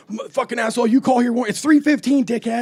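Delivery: loud, high-pitched mock angry rant; irritated outburst. Fed-up man cursing at kid prank callers, irritated. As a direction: act out the fed-up man snapping back.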